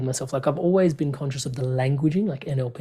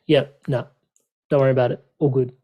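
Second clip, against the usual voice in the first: a bit louder, clipped and dismissive; curt brush-off; 4 dB louder than usual. Retold or imitated. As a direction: brush the family off with no effort. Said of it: "Like, curt".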